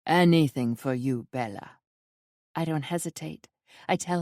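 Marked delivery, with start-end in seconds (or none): 0.1-1.8 smooth charming man. Acted. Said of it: "smooth, familiar Italian accent".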